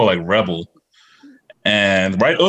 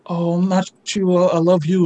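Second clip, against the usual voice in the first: imitating the girl; 8 semitones higher than usual. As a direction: coo it like the girl, pitched up. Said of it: "she's like".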